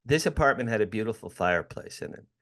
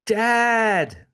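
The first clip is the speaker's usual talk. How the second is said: imitating a son's scream, much higher and louder; retold scream; 12 semitones higher, 6 dB louder than usual. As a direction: yell for dad down the hall. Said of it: "My son screamed at me again".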